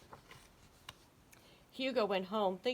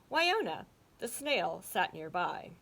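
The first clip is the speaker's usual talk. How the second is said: plain reading voice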